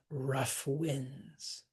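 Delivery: "rough winds" very slow, equal weight on both words; "rough winds" stretched out as two strong beats; both syllables stressed. Shown as a demonstration. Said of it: "both syllables are stressed".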